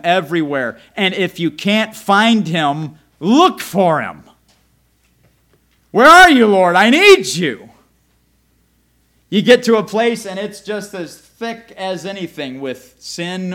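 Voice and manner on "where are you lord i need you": pleading cry. Acted. Acted prayer cry to God.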